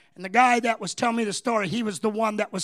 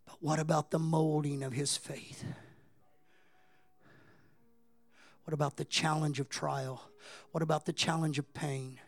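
hushed preaching; 5 semitones lower, 9 dB quieter than usual. Acted. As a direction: draw them in quietly.

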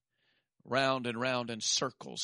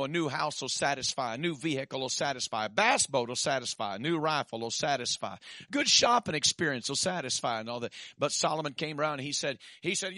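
normal talking voice

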